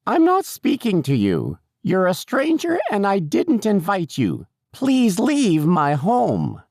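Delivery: put-on very high, slow, indignant old lady; mounting old-lady outrage, stretched slowly at the end; increasingly insistent old woman. A narrator's reading. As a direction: dismiss the stranger in a high, drawn-out voice. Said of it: "she got louder and louder".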